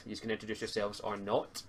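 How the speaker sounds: ordinary talk, nothing marked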